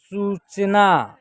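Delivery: सूचना slowly, vowels held long; drawn-out model reading. Shown as a demonstration. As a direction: stretch सूचना out syllable by syllable. Teacher models the blended word.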